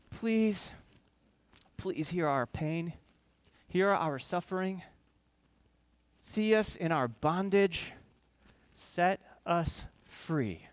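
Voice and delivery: pleading cry. Retold or imitated. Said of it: "And they're crying out to God".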